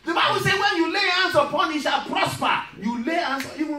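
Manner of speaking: rising to a loud, high preaching shout; full preaching. Acted. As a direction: build the congregation up to a roar.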